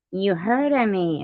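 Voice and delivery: high, slow smug boast; slow, high self-satisfied brag. Retold or imitated. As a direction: savor your own reputation. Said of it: "I'd be like".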